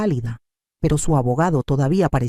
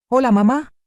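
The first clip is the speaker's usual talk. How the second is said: higher-pitched greeting, slightly raised; raised public voice; 8 semitones higher, 4 dB louder than usual. A narrator's reading. Daughter greets her mother for everyone to hear, raised voice. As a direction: greet so that others can hear. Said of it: "me dijo en voz alta".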